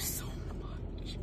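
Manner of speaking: softly whispered, slow thanks. Real, not acted. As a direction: breathe your thanks, moved. Touched, thanking the sender.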